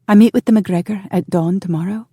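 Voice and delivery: lilting sweetness, rising at the end; sweet. A narrator's reading. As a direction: announce it sweetly, with a smile. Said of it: "She smiled sweetly".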